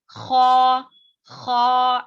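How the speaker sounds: خا with a light rasp, loud and clear; correct kha. Shown as a demonstration. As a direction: keep the scrape gentle on خا. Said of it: "اس طریقے سے ادا کرنا". (درست خا کی ادائیگی)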